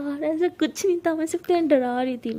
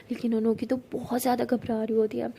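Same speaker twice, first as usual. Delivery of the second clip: ordinary delivery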